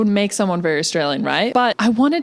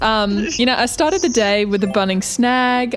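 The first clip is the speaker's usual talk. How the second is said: hammed-up Australian, pitched up; mock-Australian accent with lifted pitch; put-on Aussie accent; 4 semitones higher than usual. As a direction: play innocent in a fake Aussie accent. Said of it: "Why are you doing the Aussie accent?".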